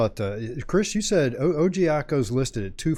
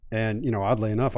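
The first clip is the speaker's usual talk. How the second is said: normal talking voice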